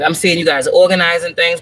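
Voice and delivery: ordinary talk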